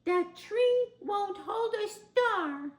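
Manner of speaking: put-on high, slow, loud child's cry; Little Bear crying out. A narrator's reading. Little Bear cries out from afar at the tree. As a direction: call out like a small bear cub from afar. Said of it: "cried out".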